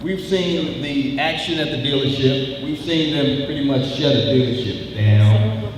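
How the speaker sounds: loud, driving rally speech to the crowd. Acted. Union organizer rousing workers at the plant, full-force rally voice.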